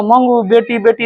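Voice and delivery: high mock calling for a daughter; imitated calling out. Mother's cry is heard at dawn, imitated calling. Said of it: "इस प्रकार का आवाज आने लगा".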